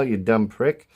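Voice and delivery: ordinary talk, nothing marked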